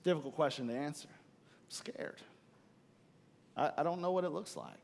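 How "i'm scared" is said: breathless whisper of fear. Retold or imitated. Listener voicing an excuse to God, whispered fear. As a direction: whisper it like a hidden confession.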